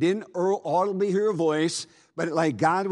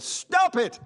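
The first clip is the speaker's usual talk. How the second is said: put-on much higher, pleading cry of a frightened girl; 13 semitones higher than usual. Joyce begging the preacher to stop, much higher pleading. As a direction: beg him to stop, high and urgent.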